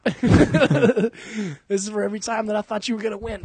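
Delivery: taunting. Pretend. Punching Chuck, taunting quote.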